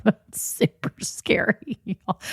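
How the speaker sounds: breathless, voiceless laugh through the words; real laughter swallowing the words. Real, not acted. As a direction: squeeze it out slowly while laughing. Laughs over scary marriage commitment.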